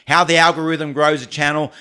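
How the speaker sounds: normal talking voice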